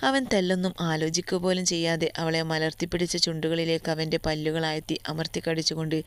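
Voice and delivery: usual speaking voice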